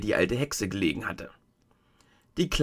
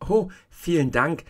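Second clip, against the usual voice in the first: grateful cry; 8 semitones higher than usual. Acted. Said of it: "rief Dorothy".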